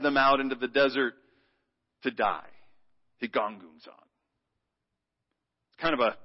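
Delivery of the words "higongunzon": Higongunzon said low and grumbly; grumbling Greek word. Shown as a demonstration. Congregation repeating the Greek word together, grumbling. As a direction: mutter Higongunzon like a complaint. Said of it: "Just sounds like grumbling".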